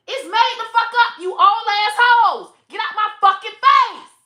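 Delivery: high, loud yelling tirade; loud high furious rant at the group; furious shout. Retold or imitated. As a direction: scream her out of your face. Yelling friend out of face.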